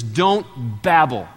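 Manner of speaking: "Don't babble" drawn out very slowly; slow, deliberate command. Retold or imitated. Jesus warns the crowd not to babble, slow and deliberate. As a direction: stretch each word as Jesus' warning. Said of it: "Jesus says".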